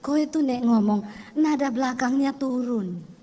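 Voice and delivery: low, slow teaching voice with a falling end; lightly voiced husband teaching intonation; patient Javanese husband. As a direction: lecture her gently, tone dropping. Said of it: "dia bilang".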